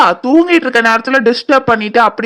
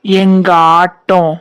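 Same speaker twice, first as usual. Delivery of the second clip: slow, drawn-out ghostly intonation; haunting slow chant; eerie ghost voice; much slower than usual. Acted. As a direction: stretch each word like a spirit. Ghost voice announces game start.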